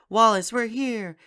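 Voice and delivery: high, loud, slow sing-song call across the enclosure. Retold or imitated. Allie visiting the sanctuary, calling out to Wallace. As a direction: call out brightly to him from afar.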